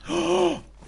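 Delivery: sharp high gasp of delight; excited gasp. Real, not acted. Finds loot.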